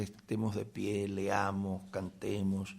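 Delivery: soft, subdued churchgoer's voice; imitating hushed service. Preacher mimicking the silence of a restrained church service, hushed. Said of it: "ese silencio".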